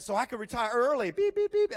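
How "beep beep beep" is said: high, loud electronic beeps; imitated detector beeps. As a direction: sound like a detector going off. Detector going off over retirement.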